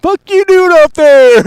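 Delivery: high, loud mock of the trailer line; high mocking parody; mocking imitation. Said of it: "quoting trailer line".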